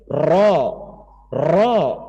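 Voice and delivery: "Ro, ro" with a light single tap, not rolled; Arabic ra. Shown as a demonstration. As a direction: tap "Ro" lightly, no heavy trill. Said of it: "Tapi bukan kayak Indonesia".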